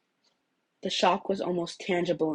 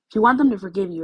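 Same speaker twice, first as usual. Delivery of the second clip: urgent plea; 3 semitones higher, 7 dB louder, faster than usual. Acted. Izuku pushes Katsuki to talk.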